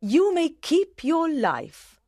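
high, slow royal pardon; a queen granting mercy; gracious queen. A narrator's reading. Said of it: "said the Queen".

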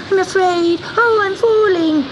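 high, crying, terrified kitten; frightened sobbing. Acted. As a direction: cry out while falling. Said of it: "sobbed Gobelino".

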